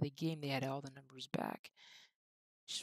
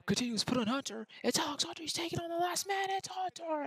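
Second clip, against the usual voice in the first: high, loud, fast commentator shout; 12 semitones higher, 7 dB louder than usual. Acted. Gamer calling Hunter's run on goal, excited commentator shout. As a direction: build as he beats the last man.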